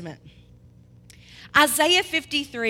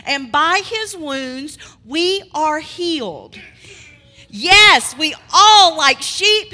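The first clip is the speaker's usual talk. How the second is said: emphatic preaching; 3 semitones higher, 9 dB louder than usual. Shown as a demonstration. Preacher repeating scripture for keyboard emphasis, full-force preaching. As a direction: preach it big, leaving room for the chords. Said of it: "I'm gonna say this again".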